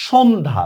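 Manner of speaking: সন্ধ্যা as "shondha"; no y or doubling in সন্ধ্যা; silent j-phala. Shown as a demonstration. Teacher shows the silent sign, সন্ধ্যা as "shondha". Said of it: "জফলা কোনো উচ্চারণই আমরা করি না".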